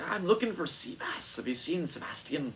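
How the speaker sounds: put-on a light, high Scottish accent for Mark. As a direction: quote Mark in a soft Scottish lilt. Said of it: "his thick-ass Scottish accent".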